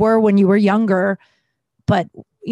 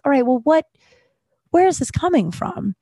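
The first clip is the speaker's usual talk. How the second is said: a lightly higher questioning inner voice; 6 semitones higher than usual. Retold or imitated. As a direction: gently quiz yourself, voice lifted. Asking herself in inner voice.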